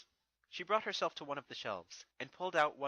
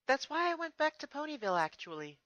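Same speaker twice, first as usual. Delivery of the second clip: fond princess; 14 semitones higher, 6 dB louder than usual. Acted. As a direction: pour out how much you missed him. Twilight answers.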